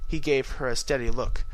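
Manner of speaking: plain reading voice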